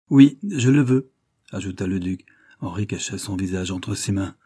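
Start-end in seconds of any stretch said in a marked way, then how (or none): 0.1-1.1 louder, final fatherly order; firm command. A narrator's reading. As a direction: lay down the law, no discussion. Father imposes his will.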